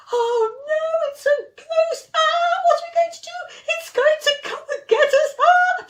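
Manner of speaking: high, panicky ghost fright; terrified. Acted. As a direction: squeal it out like scared kids seeing a ghost. Frightened friends see a ghost.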